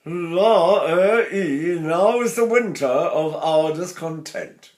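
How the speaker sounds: slow, ringing Shakespearean declamation; classical speech technique. Shown as a demonstration. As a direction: declaim like an Old Vic student. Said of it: "you'd be doing this".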